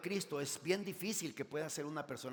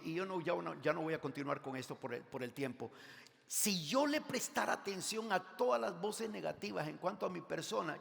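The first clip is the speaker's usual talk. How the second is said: ordinary talk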